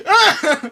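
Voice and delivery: very high, loud hammed-up yell. Acting out the hero's feeling, high loud mock scream. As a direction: belt out a cartoon scream. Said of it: "You're just going at it".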